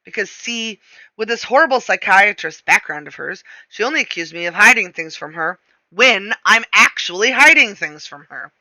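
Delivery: ordinary delivery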